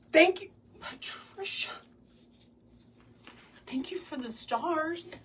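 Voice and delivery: gasp, then a high, delighted thank-you. Real, not acted. Thanking a live viewer for stars, with a delighted high gasp. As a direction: squeal your thanks at the gift.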